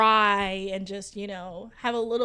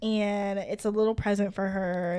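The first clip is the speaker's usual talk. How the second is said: ordinary delivery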